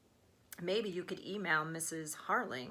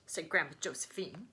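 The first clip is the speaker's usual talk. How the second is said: ordinary delivery, nothing marked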